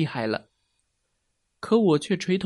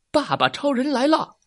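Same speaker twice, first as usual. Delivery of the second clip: high, proud superhero announcement; heroic entrance cry; 9 semitones higher, 3 dB louder than usual. A narrator's reading. Daddy Superman arrives to save his boy, high heroic call. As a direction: proclaim the hero's arrival.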